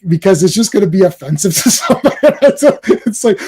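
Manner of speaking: normal talking voice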